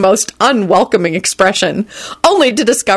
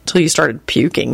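plain reading voice

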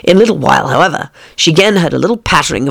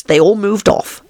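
ordinary delivery, nothing marked

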